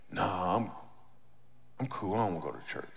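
soft, low, casual decline; low-key refusal. Retold or imitated. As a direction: play down the refusal, acted out. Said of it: "I didn't say that out loud".

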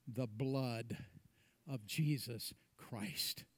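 very soft and low, nearly whispered; reverent hush. Real, not acted. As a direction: drop to a solemn, hushed near-whisper. Reverent climax of the point.

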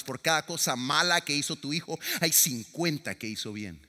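usual speaking voice

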